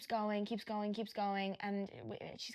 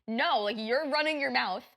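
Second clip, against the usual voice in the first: loud, higher scolding; retold angry rebuke; 4 semitones higher, 7 dB louder than usual. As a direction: cut them off and tell them off loudly. Acts out arguing with the woman.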